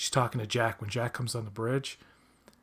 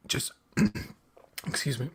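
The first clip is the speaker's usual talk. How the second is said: overcome, voice thinning to a strained whisper; choked up; 5 semitones higher, 3 dB quieter than usual. Real, not acted. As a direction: voice catching, words squeezed out between throat-clears. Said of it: "I'm getting all choked up".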